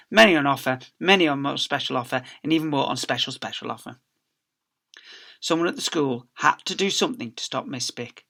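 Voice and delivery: ordinary delivery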